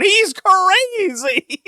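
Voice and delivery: very high, slow hammed-up cry; mock announcer. Announcers reacting to Terry Funk, hammed-up high cry. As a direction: stretch it out like a shocked commentator. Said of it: "Mark Madden and Tony Schiavone's going".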